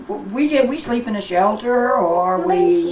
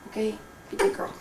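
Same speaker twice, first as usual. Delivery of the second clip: ordinary delivery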